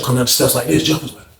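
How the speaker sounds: ordinary delivery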